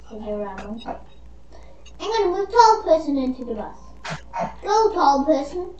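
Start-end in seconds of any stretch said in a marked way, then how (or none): 0.1-1.0 whisper. Real, not acted. Whispers mid-game.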